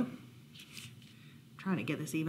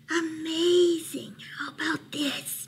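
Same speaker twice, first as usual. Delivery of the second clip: mouse under pillow; 8 semitones higher, slower than usual. A narrator's reading. Mouse testing Bear from under his pillow, high and hushing. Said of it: "Mouse said from under his pillow".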